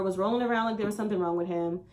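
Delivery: usual speaking voice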